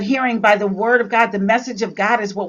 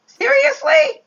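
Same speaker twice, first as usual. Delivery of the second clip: much higher incredulous "Seriously?"; exasperated; slower than usual. Real, not acted. Preacher exasperated at people believing the report, incredulous. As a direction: disbelief at their foolishness.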